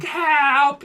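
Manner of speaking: high, loud crybaby cry for help; mock yelling. Crybaby friend calling to the rich houses, mock yelling. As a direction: yell it like a panicking kid, hammed-up. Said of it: "He, like, yelling".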